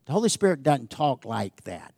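usual speaking voice

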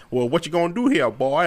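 big Southern rooster bluster; Foghorn Leghorn. Retold or imitated. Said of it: "Foghorn Leghorn ... strong Southern accent".

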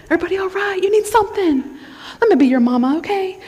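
cooing, over-caring check-in, hammed-up; fussing relational type. Preacher playing someone who mothers everybody, mock doting. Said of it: "but you are a relational".

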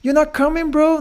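very high, slow, drawn-out disbelief; astonished coworkers. Retold or imitated. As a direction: stretch it out in mock shock, way up high. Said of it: "很多人就很惊讶".

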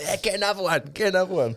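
very high mimicry of rowdy mates; hammed-up big-spender enthusiasm; mock egging-on. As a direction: urge them on in a silly high voice. Mimics mates ordering more.

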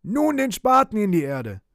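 loud, high shouted command; high, forceful call; shouting. A narrator's reading. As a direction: yell the order across a distance. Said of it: "schrie er".

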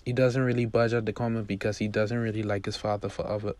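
ordinary delivery, nothing marked

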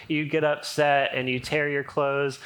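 ordinary delivery

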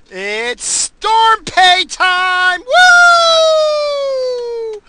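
very high, loud, slow shout, then woo; gloating cheer. Real, not acted. Worker cheering to listeners about storm pay, shouting high. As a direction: trumpet it like a game show.

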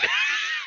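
a squealing burst of laughter; high shrieking laugh. Real, not acted. Recapping the fight for his friend, shrieking with laughter. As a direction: laugh out of sheer hype. Reliving the big moment.

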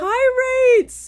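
high, loud laughing squeal. Real, not acted. Laughing repeat.

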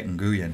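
"Nguyen" with a hard g, n-GOO-yen; English-style reading of "Nguyen"; English-accent pronunciation. Retold or imitated. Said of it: "speak with an English accent".

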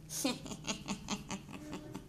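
quiet unvoiced-style snicker; soft run of chuckles. Real, not acted. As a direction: snicker softly at your own joke. Laughs at own joke.

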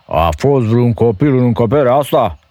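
high, loud ogre voice, suspicious. A narrator's reading. Ogre questioning his wife about a child. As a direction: probe like a distrustful ogre. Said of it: "întrebă căpcăunul bănuitor".

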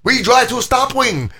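put-on high-pitched, slow Chinese-accented girlfriend; mock Chinese accent. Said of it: "She's like".

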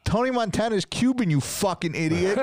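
high, emphatic imitation of a put-down; mock scolding. Wife's friend correcting the douchebag, mock scolding. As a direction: act out an exasperated woman's comeback. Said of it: "She goes".

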